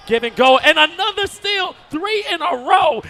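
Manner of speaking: high, loud shouted burst; excited steal call. Real, not acted. Commentator hails another steal, excited shout. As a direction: shout the run of steals in amazement.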